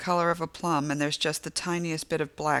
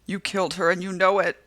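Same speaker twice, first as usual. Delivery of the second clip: louder accusing shout, pushing on killed; angry accusation; 3 semitones higher, 6 dB louder than usual. A narrator's reading. Delivery woman accusing the narrator of killing. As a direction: hurl the blame at her.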